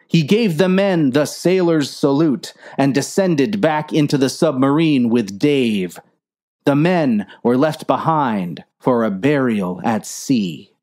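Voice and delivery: usual speaking voice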